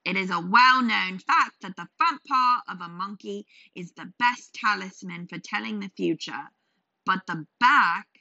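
loud, lecturing merchant; pompous know-it-all trader; indignant trader. Acted. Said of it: "The trader wasn't having it".

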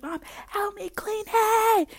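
high, drawn-out wail to mom; hammed-up helpless child's cry; whiny plea. Retells pleading to mom.

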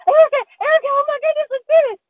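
high, loud cry of a friend's name; squealing excited call; excited yelling. Retold or imitated. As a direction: shout to your friend mid-fight with a fish. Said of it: "I'm like".

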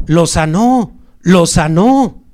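high, loud excited cries, drawn out at the end; crowd shouting. Acted. People running into the city spread the news, shouting. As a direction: cry out news of a healing. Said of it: "empiezan a gritar".

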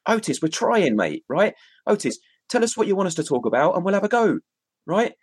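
lightly voiced impatient Otis; imitating Otis. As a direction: voice Otis nagging to move on. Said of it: "He's like".

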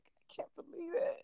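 high, breathless mock-tearful whisper; awestruck whisper. Fan retelling hearing [name] on a song, awed whisper. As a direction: squeak it out in hushed disbelief.

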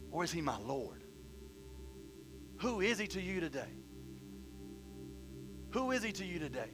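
soft, solemn closing question; hushed appeal. Real, not acted. Preacher asks congregation who he is to them, hushed. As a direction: bring it down and let each question settle.

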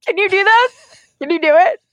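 exercise voice. Shown as a demonstration. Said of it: "but going like".

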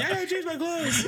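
high mocking quote; mock frantic wife. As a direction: throw her line back at her. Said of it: "just told me".

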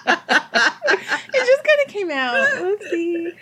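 high giggling laugh then a sheepish oopsie; giggly embarrassed aside; embarrassed laughter. Real, not acted. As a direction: laugh it off, caught out. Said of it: "So sorry".

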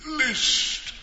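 drawn-out ghostly calling. Acted. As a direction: call like a ghost. Ghost speaking.

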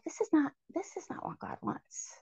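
breathy whisper to herself; whispered thought. Retold or imitated. Girl realizing inwardly, whispered. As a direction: confide the thought under her breath.

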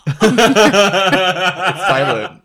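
slow, high, giggling; laughing. Real, not acted. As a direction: laugh through the punchline. Explaining joke, laughing.

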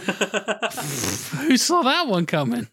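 slow, dry sarcasm; sarcastic. Acted. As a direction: drawl it out sarcastically. Sarcasm about an obvious twist.

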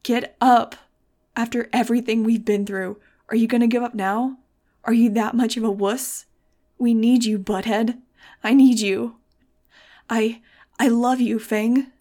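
loud, anguished plea; loud, emotional outburst on the edge of sobs; desperate yelling. A narrator's reading. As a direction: shout as if begging someone to live. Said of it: "I was choking on dry sobs now".

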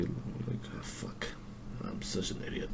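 very soft whispered aside; whispered self-scolding. Real, not acted. Speaker scolds himself over writing Lily, whispered. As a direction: mutter it under your breath, barely audible.

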